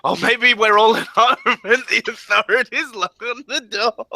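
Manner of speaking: giggling through the words; laughing. Real, not acted. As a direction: laugh while you say it. Laughing while suggesting scene.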